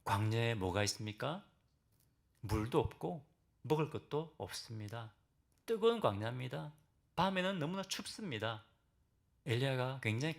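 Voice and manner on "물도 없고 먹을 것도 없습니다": ordinary talk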